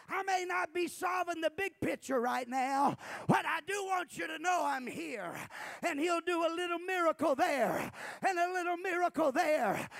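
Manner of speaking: high, loud full-force sermon delivery; impassioned preaching. Real, not acted. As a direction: preach it in loud, driving bursts.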